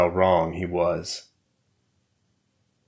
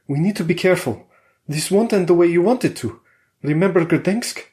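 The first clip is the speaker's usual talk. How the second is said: higher, insistent wife warning; Valeria's voice; 10 semitones higher than usual. A narrator's reading. Wife warns her husband to prepare, higher and insistent. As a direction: press him to face the risk.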